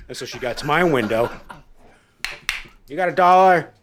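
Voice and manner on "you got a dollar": very high, loud nagging plea; insistent begging woman. Retold or imitated. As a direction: keep pestering at the window. Woman at his window.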